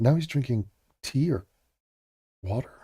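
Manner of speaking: ordinary talk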